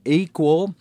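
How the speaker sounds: "Equal" lifted high and loud; stress on equal. Shown as a demonstration. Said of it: "that word equal is important".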